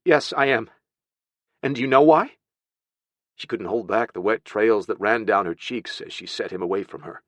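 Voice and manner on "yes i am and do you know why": raised, pointed questioning; upset aunt confronting a child; upset scolding. Acted. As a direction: start a scolding, pitched up.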